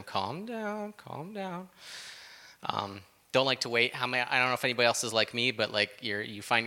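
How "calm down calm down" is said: soft, high calm down; soothing. Retold or imitated. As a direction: gently settle someone down. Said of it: "God's tapping me on my shoulder".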